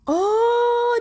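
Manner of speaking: high, sudden oh; surprised oh. Real, not acted. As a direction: catch your breath in surprise. Reacting to Johnny's confession. (oh terkejut, nada tinggi)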